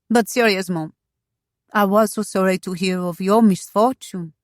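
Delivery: put-on accented society lady, effusive; French-accented, lively, then sympathetic; vivacious French wife. Femi's accented voice in story.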